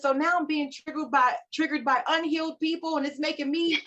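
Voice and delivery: high-pitched mock complaining; exasperated rant. Recalling how she used to complain about unhealed people, exasperated mock rant. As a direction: play up the fed-up griping, voice pushed way up.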